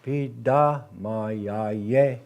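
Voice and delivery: Pidamayaye extremely slow, syllables pulled apart; slow correct version. Shown as a demonstration. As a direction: model Pidamayaye at a crawl. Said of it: "I think the correct version is".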